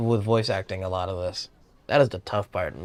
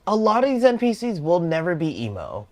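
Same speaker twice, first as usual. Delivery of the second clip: high, loud outburst; high-pitched, emphatic insistence; exclaimed protest; 8 semitones higher, 6 dB louder than usual. Real, not acted. Exasperated first take, then repeated calmly.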